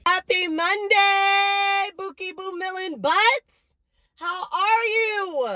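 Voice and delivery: stretched-out high hello to her followers; drawn-out high singsong hello; high slow sing-song greeting. Real, not acted. Vlogger greeting her viewers in a high, stretched singsong.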